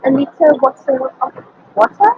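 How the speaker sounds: attempted British accent, crisp T's in "little bottle of water". Shown as a demonstration. Student attempts how Britons say it, amateur British accent. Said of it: "British accent, they would say something like".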